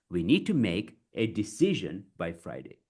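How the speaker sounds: deliberate, pausing between phrases; chunked delivery. Shown as a demonstration. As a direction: break the sentence into clear pieces. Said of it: "you break it".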